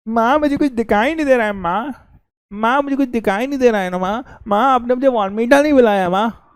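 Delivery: put-on high, whining child pleading with mother; mock sobbing child calling for maa; overacting whiny child. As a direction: ham up a kid whining to his mom. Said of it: "ज़्यादा ही बुरी over acting करी".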